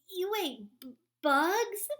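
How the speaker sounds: high, loud stammer on bugs; stuttering shock. A narrator's reading. A bat stammers at Stellaluna's bug diet, shocked. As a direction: trip over the word in disbelief. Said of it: "stuttered one".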